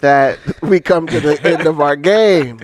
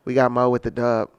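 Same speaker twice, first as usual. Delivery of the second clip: announcer voice; slower than usual. Acted. Announcing the winner.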